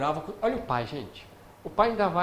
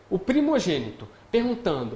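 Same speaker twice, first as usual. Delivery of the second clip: normal talking voice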